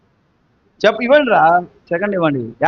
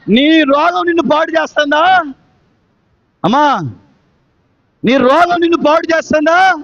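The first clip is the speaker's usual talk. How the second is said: impassioned rhetorical question, raised voice; preacher's challenge; 5 semitones higher than usual. Real, not acted. Preacher asking the congregation about their sickness, full-force challenge.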